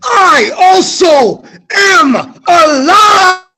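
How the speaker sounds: high, slow triumphant shout. Acted. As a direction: bellow it out high and slow, like you've just crawled out alive. Character declares survival, shouting.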